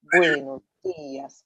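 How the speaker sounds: buenos dias drawn out slowly; slow model pronunciation. Shown as a demonstration. Teacher reviewing morning greeting for the class, slow model pronunciation. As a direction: say it slowly for learners to copy. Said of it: "Remember how you say".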